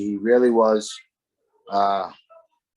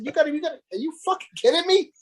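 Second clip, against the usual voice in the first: mock outrage; faster than usual. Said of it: "I said".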